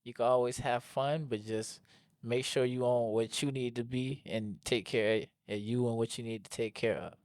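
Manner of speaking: ordinary talk, nothing marked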